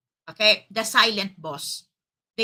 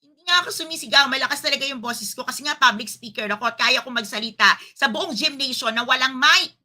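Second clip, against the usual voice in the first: acted-out quarrel, raised voice tumbling out quickly; mock shouted denial; 3 semitones higher, 5 dB louder, faster than usual. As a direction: insist at speed, voice raised, that you're not shouting. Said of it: "Ba't ka sumisigaw".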